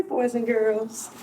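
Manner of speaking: plain reading voice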